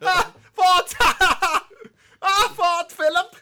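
very high, loud cartoon Canadian with laughs; Terrance and Phillip. Player showing off South Park Canadian, loud cartoon squawk. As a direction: squawk it loudly like a silly cartoon character. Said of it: "That's Terrence and Phillip".

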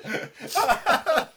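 slow, drawn out through laughter; worn-out, amused complaint; laughing defeat. Real, not acted. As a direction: groan it out while laughing.

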